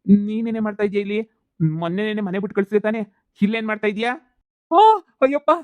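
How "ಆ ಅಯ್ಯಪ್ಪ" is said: sudden high loud cry of pain; a loud ouch, high and sharp; pained yelp. Acted. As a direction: yelp as your hair gets pulled. Husband yelps as wives beat him.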